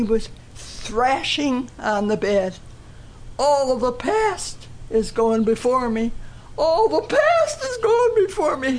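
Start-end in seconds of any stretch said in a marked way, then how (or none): 3.4-8.8 high, tearful wail of a dying man; crying. Retold or imitated. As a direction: let it break into a high cry. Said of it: "He was crying".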